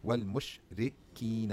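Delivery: Wal Mushrikeen stretched out very slowly syllable by syllable; sounded out slowly. Shown as a demonstration. Teacher sounding out the linked phrase slowly for learners. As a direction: drag out Wal Mushrikeen so every sound is heard. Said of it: "you have another wasla here".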